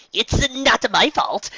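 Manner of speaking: high, slow defensive cry; cried out. Acted. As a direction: cry out your innocence. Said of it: "Trixie cried out".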